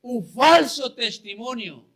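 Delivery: loud, driving sermon voice, pushing each line; fiery preaching. Acted. Preacher retelling the trial of Jesus, in fiery preaching. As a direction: preach it out to the congregation.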